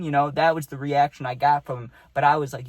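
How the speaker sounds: ordinary delivery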